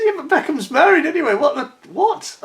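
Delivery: high-pitched mock disbelief; retold baffled objection. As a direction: squeak it out, utterly baffled. Retelling his baffled objection.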